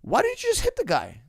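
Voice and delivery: high squeaky mock question; mocking critic. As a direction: squeal it like a clueless fan. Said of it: "if you're questioning".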